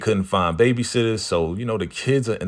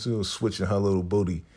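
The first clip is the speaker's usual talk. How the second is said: ordinary talk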